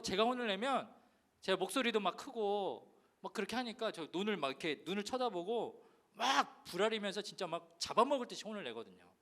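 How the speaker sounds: ordinary talk, nothing marked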